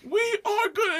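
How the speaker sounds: high, slow mock-tearful insistence; verge of tears. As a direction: plead it as if about to cry. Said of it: "almost the verge of tears".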